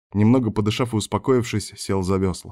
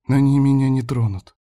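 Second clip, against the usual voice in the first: put-on girl's higher voice, hopeful; hopeful thought; 5 semitones higher, 4 dB louder than usual. A narrator's reading. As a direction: reassure yourself as a hopeful girl. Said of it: "с надеждой подумала Лада".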